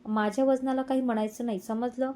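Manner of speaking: usual speaking voice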